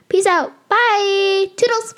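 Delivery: high bright goodbye; cheery sign-off. Real, not acted.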